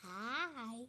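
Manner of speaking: usual speaking voice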